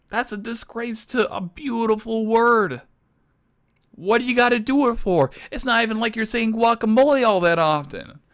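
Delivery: high-pitched indignant rant; exasperated. Acted. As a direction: protest it like a personal insult. Ranting over a shortened favorite word.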